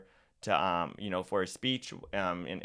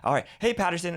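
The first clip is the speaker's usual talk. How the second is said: higher, louder call-out; punchy, raised video-opener voice; on-camera greeting; 10 semitones higher, 6 dB louder than usual. Shown as a demonstration. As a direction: snap out of the whisper into a loud greeting. Turns from whisper to on-camera voice.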